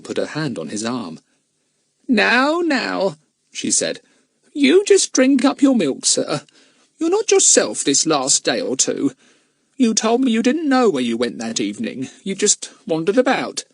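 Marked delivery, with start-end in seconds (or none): 2.1-3.2 high, chiding comfort; high-pitched there-there; fussing housekeeper. Acted. Miss Miggle soothes him. 4.5-13.6 high, firm motherly voice; high-pitched bustling reassurance; fussing housekeeper. Acted. Plays Miss Miggle fussing.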